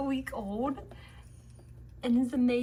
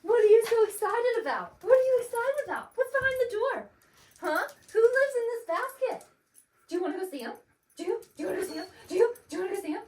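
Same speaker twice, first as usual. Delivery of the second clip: excited pet talk; 9 semitones higher, 7 dB louder, much faster than usual. Real, not acted. Excited owner baby-talks to dog.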